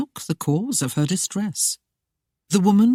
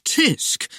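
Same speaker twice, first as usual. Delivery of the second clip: higher voiced mocking tut; chiding tut; 11 semitones higher than usual. The sheriff tuts at the lady, mockingly chiding. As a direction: tut at her like a teacher. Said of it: "Parker chided".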